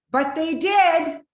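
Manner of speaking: high, loud and slow, going to her anger place; angry. Retold or imitated. Wife snapping back at the pastor's wife in anger. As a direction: let the anger burst out, drawn out. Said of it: "going to my anger place".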